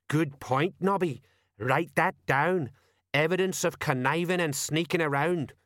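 officious sergeant, approving; Colon. Acted. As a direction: dictate like an important detective.

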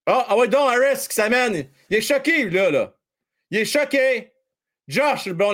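ordinary delivery

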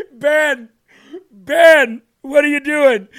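cracking up, high and loud, at a ridiculous post; half-laughing, half-crying the name out; laughing disbelief. Real, not acted. Host laughing in disbelief at a tweet's one-word takeaways.

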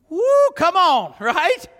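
loud, high thrill; excited whoop. Real, not acted. Preacher thrilled by the voice from heaven, whooping. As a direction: whoop at the wonder of it.

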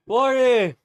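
excited shout. Real, not acted. Hitting forty nuggets.

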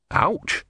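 high startled ouch; sharp yelp of pain. Acted. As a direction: yelp as the spark stings. Singed by lightning.

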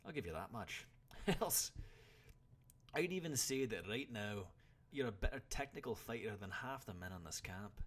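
loud, high incredulous start, then easing a little; old warrior lecturing his pupil; exasperated. A narrator's reading. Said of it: "took on a slightly exasperated expression".

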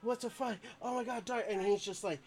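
high, frantic friend's voice; high panicky pleading; panicked friend. Retold or imitated. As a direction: squeal it like a terrified buddy. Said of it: "he's like".

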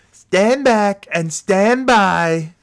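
high slow mock drawl; mocking impression.

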